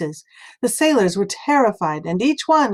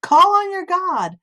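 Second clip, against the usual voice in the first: high, loud waking shout; urgent shout; 11 semitones higher, 5 dB louder than usual. A narrator's reading. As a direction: shake the sleeper awake, calling high. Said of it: "The ship's officer came and said".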